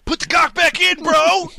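high, loud jokey yell; loud heckle. Acted. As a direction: shout it across the room.

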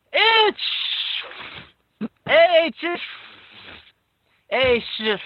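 explosive high mock sneezes; hammed-up sneezing fit; acted sneezes. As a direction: burst out each fake sneeze separately. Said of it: "couldn't you tell my awesome sneeze rendition".

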